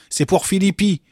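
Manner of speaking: high, loud announcement; hilarious shout. A narrator's reading. Chief guard calling for Philippi in the refectory, shouting. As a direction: shout it to the whole room. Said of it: "cria encore Hilard".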